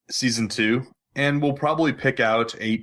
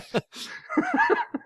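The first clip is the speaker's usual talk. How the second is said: high squealing laugh; laughing helplessly at the phone; a sudden high-pitched laugh; burst of laughter. Real, not acted.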